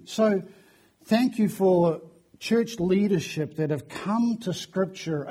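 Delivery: ordinary talk, nothing marked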